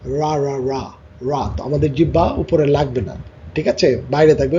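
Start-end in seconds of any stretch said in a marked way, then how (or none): 0.0-1.5 রা said with the tongue kept off the roof of the mouth; slow drilled রা, tongue not touching the top; r sound drill. Shown as a demonstration. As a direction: stretch রা with the tongue held low and out. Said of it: "দেখো আর এর সাউন্ডটা".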